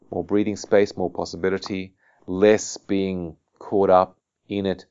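ordinary talk, nothing marked

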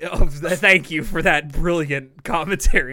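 loud, laughing sarcasm; mock the comment with fake praise; sarcastic. Real, not acted. Mocking a friend's lazy comment.

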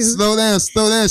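said aloud, high and firm; spoken out loud. Retold or imitated. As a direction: say it aloud this time. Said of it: "You need to speak that".

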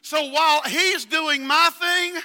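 loud, high, fervent preaching; preaching at full force. Acted. Pastor preaches to the congregation, full force. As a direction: press the point home, shouting.

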